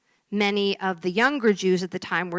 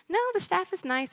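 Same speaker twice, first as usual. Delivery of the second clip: small, uncomplaining put-on voice; resigned patient; 11 semitones higher than usual. Same patient, third reply.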